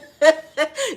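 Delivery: bursting high laugh. Real, not acted. Laughing at own Jumanji joke, high and loud.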